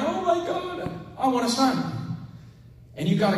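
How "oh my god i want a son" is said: high wailing cry of longing; mock crying plea. Hannah crying out to God for a son. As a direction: cry it out much higher, desperate for a son.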